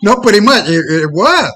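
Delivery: loud, high-pitched insistence; emphatic objection. Real, not acted. Emphatic rejection of a hedge.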